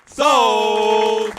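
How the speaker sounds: loud auctioneer shout; shouted sold. Acted. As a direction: bang it out like a gavel. Auctioneer closes the sale.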